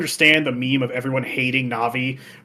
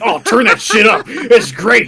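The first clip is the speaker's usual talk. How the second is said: loud, higher party shout; hyped Goron chief; 8 semitones higher, 8 dB louder than usual. Retold or imitated. Goron chief hearing the song and partying, loud excited shout. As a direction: shout like he's lost in the music. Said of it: "He's like".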